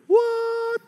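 squeaking very high what; surprised what. Real, not acted. Preacher reacting to the word again with a high squeak. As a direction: jump up in mock surprise. Interrupting his own quote.

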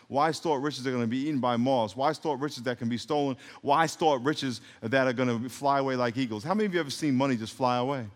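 ordinary talk

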